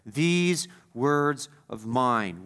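slow, weighty stress on "These" in "These words of mine."; emphatic quote. Shown as a demonstration. Preacher stressing Jesus' words to the congregation, slow and emphatic on "These". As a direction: lean hard on "These" in "These words of mine.", slowly. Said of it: "Very emphatic there".

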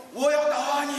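high loud echoing yell; echoed shout. Acted. Echo returns the boy's threat, loud high shout. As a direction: throw it back like an echo.

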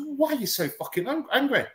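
high-pitched mock bafflement; retold incredulous challenge. Podcast guest retelling his challenge over an angry emoji, mock incredulous. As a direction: act out a puzzled challenge, voice lifted high.

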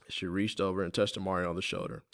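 ordinary delivery, nothing marked

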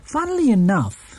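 musing aloud as Mrs Brown. A narrator's reading. Mrs Brown telling the housekeeper about Mr Curry, light. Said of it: "said Missus Brown".

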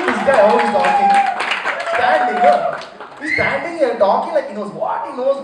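loud, excited Indian English commentator; Bangalore accent. Retold or imitated. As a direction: rave like a Bangalore uncle about his cricket days. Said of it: "guy from Saint Mark's Road, Bangalore, saying".

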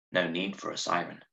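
ordinary delivery, nothing marked

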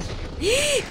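high, sudden intake of breath; sharp gasp. Real, not acted. Player startled in the fight, sharp gasp. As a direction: gasp in alarm. Reacting to the game.

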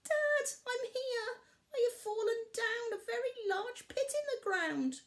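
tearful call for rescue; cub calling up. A narrator's reading. Barley in the pit calling his dad, tearful.

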